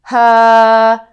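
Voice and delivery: loud, controlled ha driven from the diaphragm; powerful ha. Shown as a demonstration. Voice teacher showing a powerful diaphragm-driven ha. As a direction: punch each ha out from the belly. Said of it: "If this tone isn't controlled and powerful".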